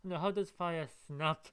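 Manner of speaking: ordinary delivery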